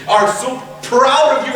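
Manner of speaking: ordinary talk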